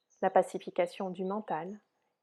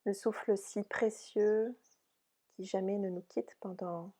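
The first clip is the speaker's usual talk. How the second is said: soft, unhurried yoga nidra guidance; softly spoken relaxation voice, spacious phrasing; guided meditation; 4 dB quieter than usual. Real, not acted. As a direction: guide them gently into rest, with long pauses.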